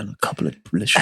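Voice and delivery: hushed, low, indulgent; soft advert voice. Shown as a demonstration. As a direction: whisper it like a luxury advert. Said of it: "say it really softly".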